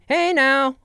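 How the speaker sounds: high, loud flamboyant call; hammed-up, drawn-out sassy shout; camp theater-kid greeting. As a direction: belt out a campy hello. Acts out how people see them.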